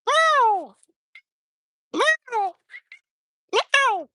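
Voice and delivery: high imitated cat meows; mock meowing. Vlogger calling street cats to food, high imitated meows.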